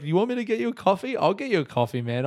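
high, fast incredulous; hammed-up surprise at the request; mock disbelief. Own mock-incredulous reply.

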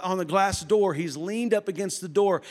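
usual speaking voice

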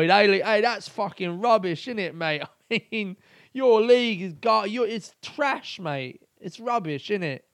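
loud, high mock of a smug visitor; sneering impression of a smug foreign fan. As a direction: taunt like a condescending tourist. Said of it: "come over here and be like".

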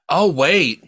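ordinary talk, nothing marked

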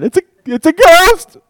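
high, loud panicked yell; mock terrified scream of a ghost; terrified shriek. Shown as a demonstration. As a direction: shriek it in terror. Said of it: "I would sound something like that".

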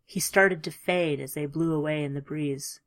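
ordinary delivery

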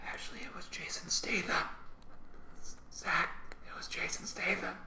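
very soft, confidential whisper; mock-secret hushed aside; joking whisper. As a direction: whisper a fake correction.

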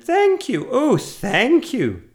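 high, loud, gushing witch; effusive, drawn-out storybook thanks; grateful cry. A narrator's reading. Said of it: "the grateful witch cried".

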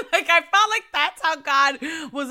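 bright laughing admission. Real, not acted. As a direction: push the line through a laugh. Laughing at own comparison.

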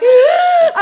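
high, loud laughing gasp; sharp startled laughing gasp. Retold or imitated. Dolphins leap on screen.

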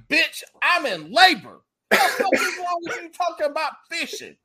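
a mock yell of disbelief; a wife in labor snapping at her husband in mock fury; mock outrage. Wife in labor snaps back.

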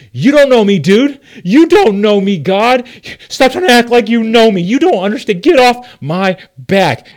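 loud, high, defiant yelling at God; angry outburst. Retold or imitated. Jonah lashing out at God, loud angry yell. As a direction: push God away in fury. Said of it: "Jonah's like".

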